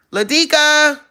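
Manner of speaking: high, loud call to the dog. Real, not acted. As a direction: holler the name through the house. Calling out to dog.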